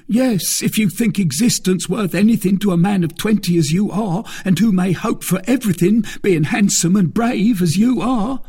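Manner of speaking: high, loud, ingratiating plea; pleading bandit. A narrator's reading. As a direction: flatter your captor to save your life. Bandit pleads for his life.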